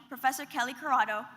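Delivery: ordinary talk, nothing marked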